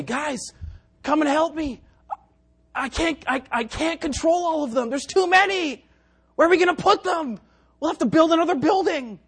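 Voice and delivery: high, strained call for help; mock overwhelmed. Fisherman calling friends to help, mock panic. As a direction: call out as if swamped by the catch. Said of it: "you'll have to say".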